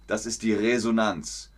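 normal talking voice

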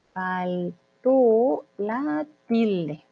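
extremely drawn-out "Faltó la tilde", split by syllable; word-by-word crawl through "Faltó la tilde"; very slow dictation. Shown as a demonstration. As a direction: stretch each syllable of "Faltó la tilde" with gaps. Teacher repeats phrase much slower.

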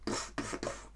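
soft voiceless puffs in rhythm; imitated hammer thuds. Shown as a demonstration. Teacher asking what a hammer does, imitated hammer thuds. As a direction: mime hammer blows with puffed noises.

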